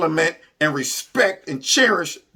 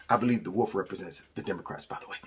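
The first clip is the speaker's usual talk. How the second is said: whispered aside; 11 semitones lower, 11 dB quieter, much faster than usual. Real, not acted. Muttered aside about the wolf.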